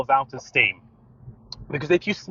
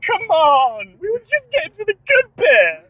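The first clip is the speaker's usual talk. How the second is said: much higher and louder whine; mock disappointment; 5 dB louder than usual. After the caller hangs up, mock disappointed and much higher. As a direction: pretend to be let down.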